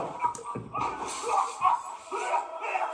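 shrieking laugh. Real, not acted. Wrong turn, chaser near.

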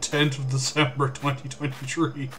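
ordinary delivery

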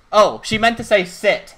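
usual speaking voice

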